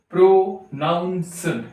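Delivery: "प्रोनाउंस्ड" slowly, pro and naunsd held long; drawn out. Shown as a demonstration. Teacher showing it runs long, "प्रोनाउंस्ड" stretched slowly. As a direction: drag out each part of "प्रोनाउंस्ड". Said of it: "तो काफी लंबा जा रहा है ना".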